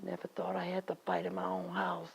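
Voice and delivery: very low, very soft murmur; hurt, hushed. Acted. Woman betrayed in her own house, hushed hurt. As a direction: sink right down in pitch and volume.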